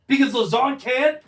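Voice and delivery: normal talking voice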